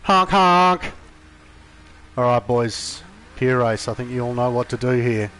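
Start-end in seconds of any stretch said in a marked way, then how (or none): 0.0-0.9 high loud clown honk; silly clown. Pretend. Silly clown horn noise.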